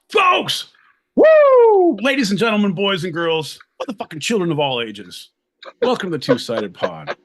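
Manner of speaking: booming showman opening, fading down; grand entrance announcement. Pretend. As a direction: announce it like a ringmaster. Podcast host's grand show opening.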